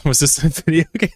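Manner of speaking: laughs as he asks; laughing. Real, not acted. Answering chat about the game story, laughing. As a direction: question breaking into giggles.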